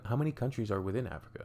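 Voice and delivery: ordinary talk, nothing marked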